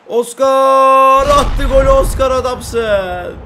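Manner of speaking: loud, slow drawn-out cheer; cheering shout. Real, not acted. Player cheering as teammate Oscar scores. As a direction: roar the teammate's name in joy.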